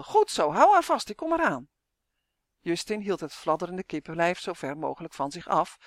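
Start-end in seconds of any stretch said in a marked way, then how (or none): 0.0-1.7 mildly raised high call while running; shouting. Acted. Girl calls to the boy holding her hen, raised voice. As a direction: call out encouragement, a bit loud. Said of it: "maar het meisje gilde".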